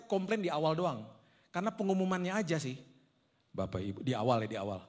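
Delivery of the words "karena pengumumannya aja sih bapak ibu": usual speaking voice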